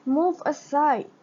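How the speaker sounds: high, loud witch shout. Acted. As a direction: shove her aside with a shout. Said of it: "the witch shouted".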